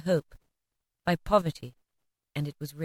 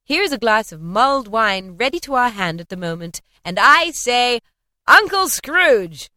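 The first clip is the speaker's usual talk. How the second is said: toasting call; 3 semitones higher, 6 dB louder than usual. A narrator's reading. Fred raising a toast to his uncle, loud. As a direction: lift the glass and call it out. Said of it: "said Fred".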